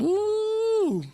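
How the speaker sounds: excited ooh. Real, not acted. Hyped reaction to guest's crew.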